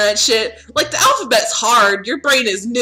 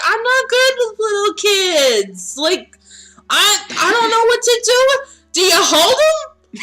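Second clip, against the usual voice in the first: high, drawn-out flustered pleading; panicked character; 10 semitones higher, slower than usual. Acted. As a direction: play helplessly out of your depth, high-pitched. Acts panicked character unsure with kids.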